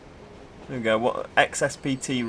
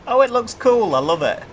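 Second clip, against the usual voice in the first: excited; 11 semitones higher, 6 dB louder than usual. Real, not acted. Delighted at the train livery.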